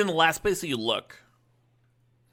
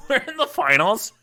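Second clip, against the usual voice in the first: loud, high cheer; excited; 10 semitones higher, 7 dB louder than usual. Real, not acted. Streamer celebrating reaching the finals, loud and high. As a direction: announce it with a burst of joy.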